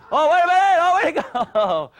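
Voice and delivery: loud, high cry of mock surprise; playful mock alarm. Coach joking with kids during a kicking drill, playful mock alarm. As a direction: exclaim high and loud, playing it up.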